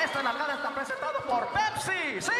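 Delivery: loud shouted sponsor plug; radio ad read. Retold or imitated. Radio host plugging the sponsor, loud shout. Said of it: "talking like that".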